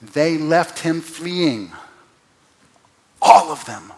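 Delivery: very slow, loud, with a hammered all; emphatic reading. Shown as a demonstration. Preacher reading the Greek word order, loud and slow. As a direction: drive home all of them. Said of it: "this sentence reads like this".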